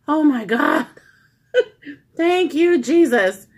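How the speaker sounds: high, loud mock-sob of relief; acted-out outburst of relieved thanks; dramatic relief. Patient retelling learning the epidural is done, dramatic relief.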